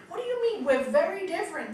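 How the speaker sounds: high, loud protest; indignant couple. Retold or imitated. Couple objecting to the counsellor, high and indignant. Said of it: "they tell me".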